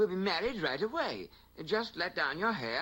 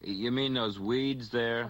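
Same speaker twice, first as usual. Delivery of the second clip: low husband voice; 8 semitones lower, slower than usual. Acted.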